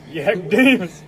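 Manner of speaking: mock shout. Echoing the joke name.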